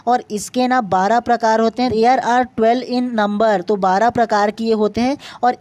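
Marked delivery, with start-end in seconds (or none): 1.9-3.7 slow dictation. Shown as a demonstration. Reads the count from the slide.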